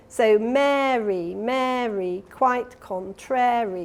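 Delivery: slow, with Mary drawn out and stressed; slow rhythmic rhyme. Shown as a demonstration. Lecturer shows the slow delta rhythm: slow stressed recital. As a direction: beat out the twice-a-second rhythm slowly. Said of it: "this is the twice a second".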